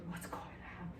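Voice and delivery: breathy whisper of a worried roommate; whispered roommate question. Retold or imitated. Roommate asking the angry student what's wrong, whispered. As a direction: ask it under your breath. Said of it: "your roommate say".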